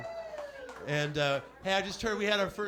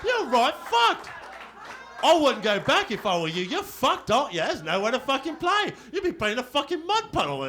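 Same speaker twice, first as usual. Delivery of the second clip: higher, louder, gleeful British taunt; mock British gloating; 4 semitones higher, 4 dB louder than usual. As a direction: rub it in like a smug Brit. Imitating British acquaintance gloating.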